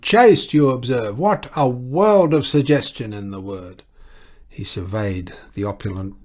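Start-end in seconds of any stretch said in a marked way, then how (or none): 0.0-3.8 high shout then carried on loud; excited shout. A narrator's reading. Clergyman shouts gleefully to his guests. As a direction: shout it, relishing the word. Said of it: "he shouted".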